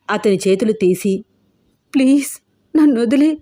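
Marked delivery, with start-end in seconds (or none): 1.9-3.4 put-on higher, pleading girl near tears; a young woman's crying plea; tearful plea. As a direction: beg to be let go, voice lifted and upset. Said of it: "అంది రూప ఏడుస్తూ".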